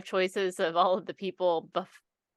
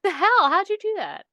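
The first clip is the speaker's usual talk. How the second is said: mock astonishment; 11 semitones higher, 4 dB louder than usual. Mock reaction to others' weight loss.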